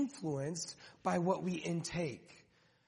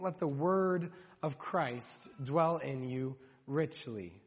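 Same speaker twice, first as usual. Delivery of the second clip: slow emphatic reading; 5 semitones lower, slower than usual. Shown as a demonstration. Preacher slowly reading the verse to the congregation. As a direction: read the verse slowly, weighing each word. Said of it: "Think about that just for a moment".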